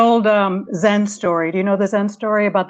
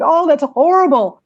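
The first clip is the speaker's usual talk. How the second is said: mock horrified neighbor; 7 semitones higher, 5 dB louder than usual. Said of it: "the neighbor's like".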